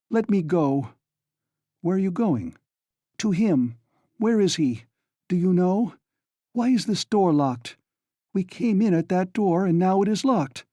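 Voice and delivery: mildly frantic questioning; agitated Donya. A narrator's reading. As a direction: demand answers, rattled. Donya upset, demanding.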